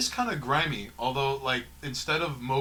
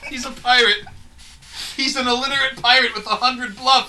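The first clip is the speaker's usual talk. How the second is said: excited shout; 11 semitones higher, 13 dB louder than usual. Real, not acted. Hyping the illiterate pirate bit.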